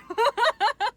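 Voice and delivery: real laugh, high and loud; high burst of laughter. Real, not acted. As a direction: laugh out at the absurdity. Laughing at her own shock.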